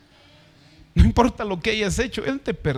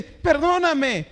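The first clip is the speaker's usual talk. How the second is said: pleading servant; 6 semitones higher, much slower than usual. Acted. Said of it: "Y él le dice".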